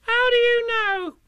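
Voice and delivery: very high, slow indignant squeak; indignant little Roo. A narrator's reading. Roo challenges Rabbit about the cough, indignant high squeak. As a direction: protest in a tiny piping voice. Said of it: "asked Roo indignantly".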